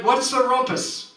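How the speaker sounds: high, called-out question; officer's cry. Acted. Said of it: "he cried".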